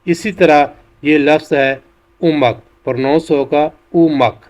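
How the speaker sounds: ordinary talk, nothing marked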